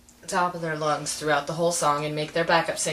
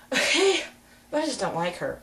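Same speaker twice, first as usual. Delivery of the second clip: ordinary delivery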